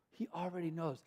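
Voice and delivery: unvoiced secret whisper; whispered futile, nearly silent. Shown as a demonstration. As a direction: lean in and breathe it.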